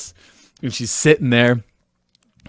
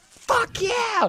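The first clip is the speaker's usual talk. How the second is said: high shrieked party cheer; a queen losing it at the show; wild cheer; 4 dB quieter than usual. Retold or imitated. Queen in the front row, shrieking a high cheer.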